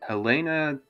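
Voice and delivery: normal talking voice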